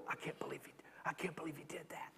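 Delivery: hushed, whispered mock disbelief; scandalized whisper. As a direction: whisper it like shocked church gossips. Mock scandalized church members' reaction.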